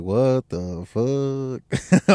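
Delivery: slow, drawn-out shocked question; stunned disbelief. Retold or imitated. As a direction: stretch it out in disbelief. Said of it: "first I was like".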